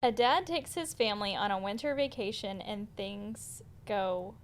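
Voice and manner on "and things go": deadpan sarcasm, trailing softer; sarcastic. Real, not acted. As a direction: drop "go great" with dry irony. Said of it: "kinda sarcastic".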